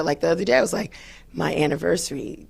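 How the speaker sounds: usual speaking voice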